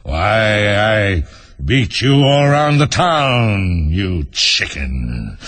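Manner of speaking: drawn-out lion's boastful anger; angry. A narrator's reading. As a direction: drag out the threat, sinking onto the insult. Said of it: "the lion replied angrily".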